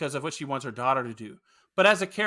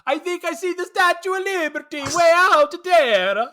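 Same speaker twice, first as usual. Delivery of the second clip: high, loud mock Italian accent; over-the-top imitation of a bad movie accent; Fabrizio's accent; 13 semitones higher, 7 dB louder than usual. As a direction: belt out a hammed-up Italian immigrant accent. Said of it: "Fabrizio ruining it with his accent".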